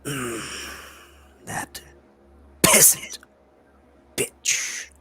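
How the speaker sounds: slow, eerie whispered taunt; mocking creature whisper. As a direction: savor each word like a gloating horror. Creature taunts about Helene.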